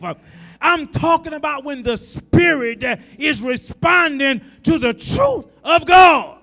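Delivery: loud, rising preacher's cry; full-voiced gospel preaching; preaching at full force. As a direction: preach it out to the congregation. Preacher building to a shout.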